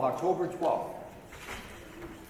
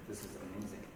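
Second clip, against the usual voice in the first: hushed; 9 semitones lower, 16 dB quieter, much faster than usual. Retold or imitated. Quiet retold hopeful quote.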